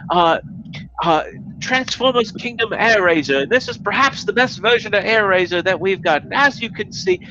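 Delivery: put-on high, fast eager kid voice; enthusiastic kid reviewer. High fast eager voice imitating a kid reviewer's intro. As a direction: rattle it off like an excited kid. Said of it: "And he's like".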